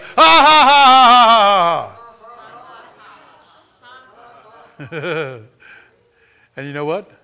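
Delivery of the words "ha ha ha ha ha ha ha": huge deliberate laugh, dying away; triumphant imitated laugh. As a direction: blast the laugh, then let it tail off. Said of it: "laughing and rejoicing".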